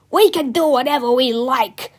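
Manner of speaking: loud, defiant snarl. A narrator's reading. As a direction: snarl back at the boys. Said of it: "snarled Mister Meena".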